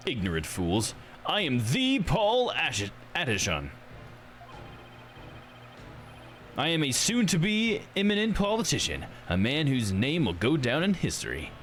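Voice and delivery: self-important candidate scolding the ignorant; pompous politician, scornful. Acted. Politician announcing himself to those who don't know him, pompous.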